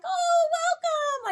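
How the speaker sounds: very high, slow sing-song welcome; doctor's wife all happy. Retold or imitated. Wife greeting the injured guest, high sing-song welcome. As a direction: gush a bright hello like a delighted host. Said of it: "like, all happy".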